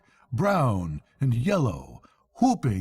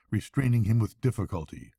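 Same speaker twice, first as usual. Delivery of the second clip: plain reading voice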